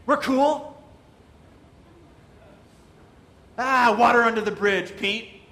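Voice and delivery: loud matey back-slap; loud, chummy pal voice; buddy-buddy. Retold or imitated. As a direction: brush it off like an old pal, loudly. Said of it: "put Peter in a headlock and say".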